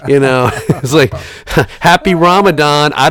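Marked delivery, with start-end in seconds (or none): 1.8-2.9 mock cheery. Mock reply to the holiday texts.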